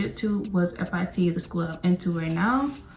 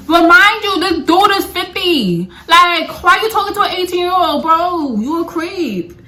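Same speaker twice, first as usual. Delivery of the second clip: much higher and louder, indignant; outraged; 8 semitones higher, 8 dB louder than usual. Real, not acted. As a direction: rant at him like he's a creep. Outraged rant about fifty-year-old teacher.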